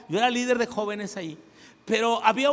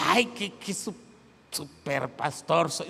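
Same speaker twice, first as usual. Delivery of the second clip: smug boast; 6 semitones lower than usual. Pretend. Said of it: "no es porque yo dije".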